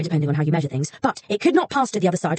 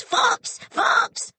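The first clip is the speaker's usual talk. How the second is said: very high shrill bird cry; shrieking raven. A narrator's reading. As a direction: screech the name like a raven overhead. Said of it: "a wheeling raven shrieked".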